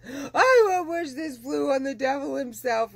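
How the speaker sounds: high, loud re-enacted crying plea; reliving a hysterical cry, voice up and straining; retold sobbing. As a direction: sob it out the way she did then. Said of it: "I'm bawling hysterical".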